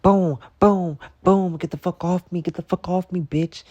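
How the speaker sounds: high, loud yells while hitting; panicked yelling. Retold or imitated. Fighting the hawk off, high, panicked yelling. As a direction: yell and swing at the bird.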